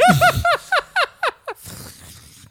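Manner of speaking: shrieking burst of laughter; big squealing laugh; loud high laugh. Real, not acted. Laughing at candy sound game.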